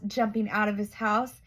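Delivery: usual speaking voice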